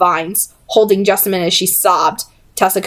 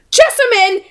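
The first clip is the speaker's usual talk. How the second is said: urgent call; 13 semitones higher than usual. A narrator's reading. Tessa calls her to stop.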